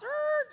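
high-pitched screeching-brakes noise; imitated brake screech. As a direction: squeal like tires stopping hard. Imitates car screeching to a stop.

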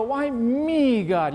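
high, pleading complaint to God, mock self-pity; whiny why me. Believer complaining to God about troubles, high whiny self-pity. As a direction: whine it like a wronged victim. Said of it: "when we stand and we go".

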